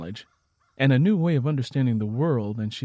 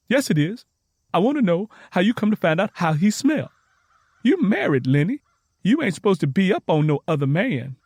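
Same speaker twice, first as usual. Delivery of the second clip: higher, louder, indignant pushback; defensive; 7 semitones higher, 6 dB louder than usual. A narrator's reading. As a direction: defend yourself, accusing her friend. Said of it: "Tangy got defensive".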